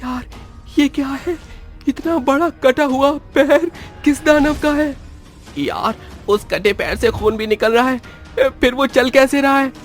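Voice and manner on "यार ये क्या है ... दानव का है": high-pitched frightened disbelief; horrified. Acted. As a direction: stare at a monster's foot and ask in dread. Horrified at a severed foot. (डरी हुई, ऊँची आवाज़)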